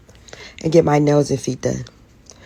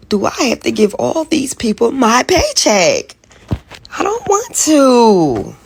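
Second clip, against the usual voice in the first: higher, louder, slow whining cry; meltdown whine; 6 semitones higher, 6 dB louder, slower than usual. Real, not acted. Woman having a morning meltdown before work, whining. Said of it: "having a little meltdown".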